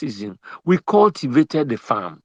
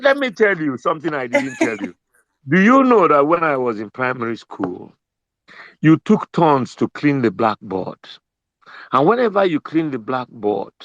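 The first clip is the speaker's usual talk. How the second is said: ordinary delivery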